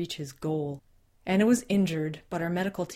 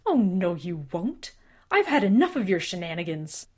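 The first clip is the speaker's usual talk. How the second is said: angry; 6 dB louder than usual. Acted. Father throws visitor out.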